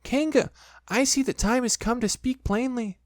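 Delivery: high squeaky voice trying to sound brave; a tiny pig puffing himself up; Piglet's high brave voice; brave little voice. A narrator's reading. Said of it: "in as brave a voice as he could".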